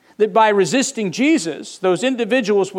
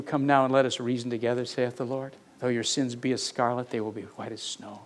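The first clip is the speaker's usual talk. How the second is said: softer, fast, tender recitation; fond quiet recital; 7 semitones lower, 9 dB quieter than usual. Shown as a demonstration. Preacher lovingly recites his favorite passage, soft and tender. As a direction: savor the verse quietly like a favorite. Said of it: "I love that passage".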